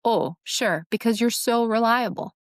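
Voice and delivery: sarcastic mock agreement; biting fake praise, leaning on SO. Shown as a demonstration. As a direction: agree while clearly meaning the opposite. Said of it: "sarcasm says".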